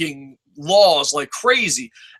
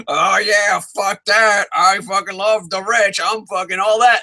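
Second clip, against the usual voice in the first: put-on swaggering braggart voice; hammed-up big talker; mock Facebook show-off. Imitating Facebook show-offs acting rich.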